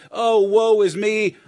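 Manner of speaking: loud, drawn-out mock lament; mock self-pity. Preacher mimicking the place of despair, mock self-pity. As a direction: wail it slowly in exaggerated woe.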